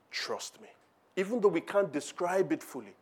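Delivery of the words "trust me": soft whispered "Trust me". Acted. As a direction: lean in and whisper it like a secret. Intimate reassurance from Jesus.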